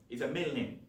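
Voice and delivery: ordinary talk, nothing marked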